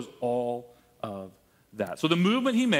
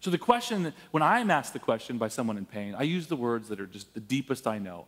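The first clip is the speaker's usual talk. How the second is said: ordinary delivery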